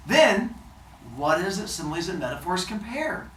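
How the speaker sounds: usual speaking voice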